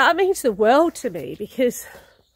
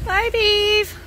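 fond goodbye; 11 semitones higher, 5 dB louder than usual. Real, not acted. Saying goodbye to the horse.

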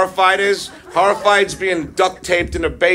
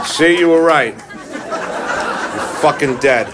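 mock menace; 4 semitones lower, slower than usual. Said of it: "And I said".

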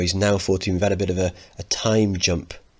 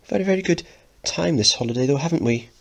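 high anxious mother; 8 semitones higher, faster than usual. Acted. Mrs Lyons, anxious.

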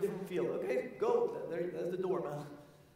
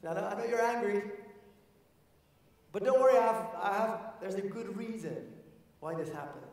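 put-on higher, louder nervous kid voice; excuse-making grandson; 5 semitones higher, 3 dB louder than usual. Grandson lying to grandfather, higher pleading voice. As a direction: sell the made-up story.

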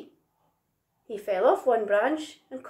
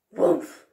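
loud, higher "whoomf" of impact; landing thump; 5 semitones higher, 5 dB louder than usual. A narrator's reading. Little Monkey crashes onto the bed, loud whoomf.

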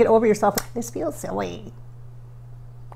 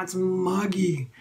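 slow muggy drawl; 4 semitones lower, much slower than usual. Real, not acted. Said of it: "repeated for effect".

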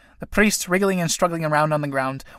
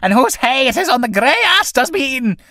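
high, loud Irish scolding; scolding tinker woman; 9 semitones higher, 6 dB louder than usual. Acted. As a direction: rail at him, voice raised high. Tinker woman curses the priest.

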